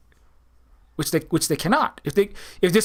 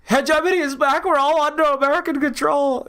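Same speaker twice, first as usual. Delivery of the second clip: much higher, hammed-up panicked voice; higher mock alarm from panicking commentators; mock freaking out; 8 semitones higher than usual. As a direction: parody people freaking out. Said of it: "everybody's freaking out, like".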